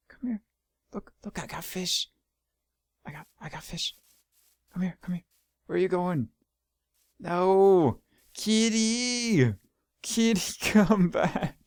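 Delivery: coaxing a pet. Real, not acted. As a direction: lure the animal gently with a treat. Calling the cat back.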